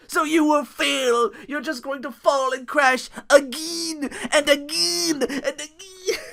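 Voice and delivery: gloating taunt. Retold or imitated. Mimicking Matt Hardy taunting Jeff, slow villainous gloating. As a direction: taunt him like a cartoon villain.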